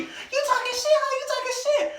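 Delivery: very high-pitched screechy challenge; mock confrontational sister. Sister comes back at him, high mock aggression. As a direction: imitate an angry sister squaring up.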